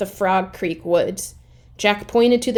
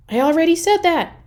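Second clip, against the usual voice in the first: exasperated; 9 semitones higher, 4 dB louder, faster than usual. Acted. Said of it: "Annie corrects Jack".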